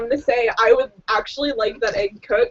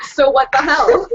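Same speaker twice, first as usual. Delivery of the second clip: cheerful, a bit louder than usual; very cheery; 5 dB louder than usual. Acted. Player greeting everyone at the table, very cheerily. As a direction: chirp it at the whole table. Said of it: "very cheerily look at everyone".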